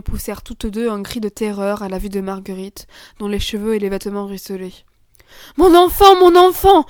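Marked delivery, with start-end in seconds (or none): 5.6-6.8 high, loud mother's cry; alarmed exclamation, raised in pitch and volume. A narrator's reading. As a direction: cry out in alarm for your child. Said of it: "s'écria madame de Rosebourg".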